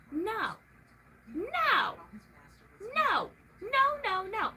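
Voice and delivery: building no into a yell; rising no. Real, not acted. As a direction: refuse harder every time. Escalating refusal.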